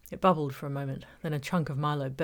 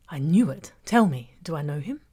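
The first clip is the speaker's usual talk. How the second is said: louder, eager gotcha; excited triumph; 9 dB louder than usual. Acted. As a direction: burst out with delighted curiosity. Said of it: "Her eyes sparked".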